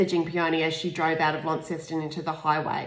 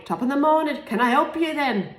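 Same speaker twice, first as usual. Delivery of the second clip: a hint of an Irish lilt, laughing; lightly voiced Irish security man; Irish accent; 8 semitones higher, 3 dB louder than usual. Irish man offering help, a hint of an Irish accent. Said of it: "I'm not gonna do the Irish accent".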